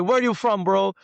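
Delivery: high, loud curious bro call; guy across the street. Retold or imitated. Local guy asks where he's from, high loud bro voice. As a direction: shout the question over like an eager local. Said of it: "He's like".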